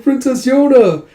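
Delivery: high, loud, drawn-out gush; gushing call. Acted. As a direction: call the princess adoringly, stretching it out. Soldiers crowd round the princess.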